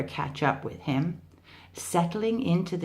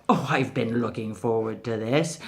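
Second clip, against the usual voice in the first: low relishing tiger; 6 semitones lower, faster than usual. Acted. Tiger relishing his lunch.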